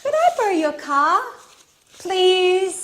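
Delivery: high, loud, wheedling request; pleading. Acted. Alice asks her friend to lend the car, pleading. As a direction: beg a favour, voice lifted and insistent.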